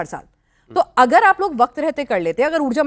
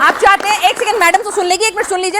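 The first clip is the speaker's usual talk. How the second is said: higher, insistent anchor voice; urgent, pitched-up interruption; raised-voice appeal; 5 semitones higher than usual. Real, not acted. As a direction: cut through the crosstalk to be heard. Anchor demands a hearing amid shouting.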